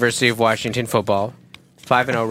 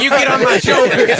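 playful shout; 11 semitones higher, 4 dB louder than usual. Pretend. Acting pool chicken fight.

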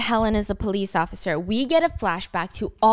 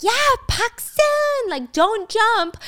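mock yell, pitched much higher and slow; retold scream; 13 semitones higher, 4 dB louder, much slower than usual. June calling to Paxton on the bridge, a high drawn-out mock yell. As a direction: call out across the river, drawn out. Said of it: "screaming, like".

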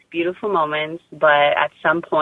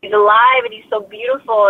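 overwhelmed tearful words; 6 semitones higher than usual. Retold or imitated. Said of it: "I told my husband".

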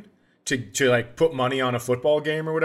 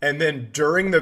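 usual speaking voice

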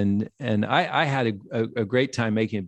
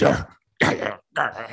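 put-on drunken growl; 3 semitones lower, slower than usual.

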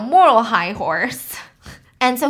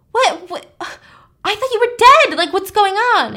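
high, loud disbelief; a startled friend, pitched way up and louder; shocked; 9 semitones higher, 6 dB louder than usual. Retold or imitated. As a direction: gasp it out like she thought her friend was dead. Louise shocked her friend lives.

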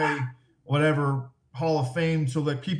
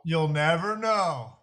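mock mysterious; much slower than usual. Echoing the tease.

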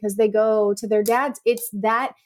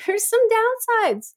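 high, slow wry aside; slow, pitched-up wry remark; wry understatement; 12 semitones higher, slower than usual. Real, not acted. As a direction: drop it slowly and high, tongue in cheek.

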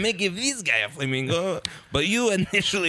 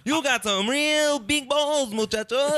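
[name] impression; 9 semitones higher than usual. Ribbing the hosts as [name], in a hammed-up Mexican accent. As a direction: drag it out like a mock Latin rocker. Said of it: "[name] impression".

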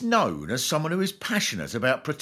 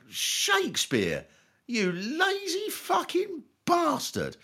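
higher, slow mock exasperation; exasperated scolding; 14 semitones higher, slower than usual. Thinking about Shakespeare's lazy rhymes, mock exasperated. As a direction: tut at the Bard in mock outrage. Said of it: "I think".

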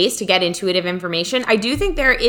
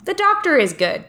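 the quote said much higher, not in a British accent; not British, just higher; 11 semitones higher than usual. Shown as a demonstration. Correcting her friend, she says the dream voice much higher. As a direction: lift "The doctor is good" up high with no accent. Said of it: "It wasn't British. It was just like".

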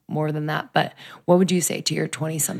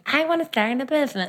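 naive voice; 8 semitones higher than usual. Retold or imitated. Naive quote, higher voice.

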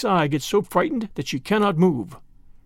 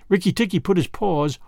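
plain reading voice

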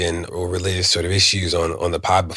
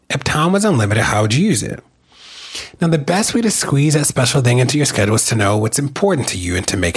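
upbeat sponsor read, higher than his chat; 6 semitones higher than usual. Acted. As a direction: sell it like a friendly host ad. Host reads sponsor ad.

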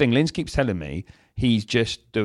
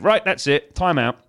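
put-on higher and firm, telling the child off; stern scolding; 6 semitones higher, 4 dB louder, slower than usual. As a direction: snap out the time-out order. Father scolding toddler.

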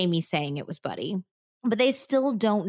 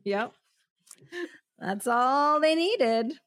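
slow dramatic; 6 semitones higher, slower than usual. Real, not acted. Dramatic narration beat.

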